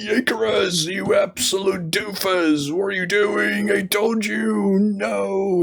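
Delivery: dad yelling at a reckless son, hammed-up; lightly voiced outraged father; exasperated father. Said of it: "and his father's".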